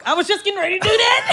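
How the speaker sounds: excited. Real, not acted. Protests laughing, loud.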